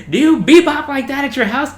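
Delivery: very loud, high mock reprimand; hard chief's yell. Chief to recruit who can't march, mock angry yell. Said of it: "our chief was trying to be hard on him".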